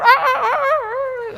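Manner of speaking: high, slow mock rooster crow. Preacher mimicking the rooster, a high mock crow.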